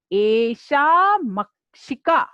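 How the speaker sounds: एषा मक्षिका said slowly, each syllable separate; recite for repeating. Shown as a demonstration. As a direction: lead the class in saying एषा मक्षिका. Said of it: "आप साथ में बोलिए". (साथ में बोलने के लिए धीमा उच्चारण)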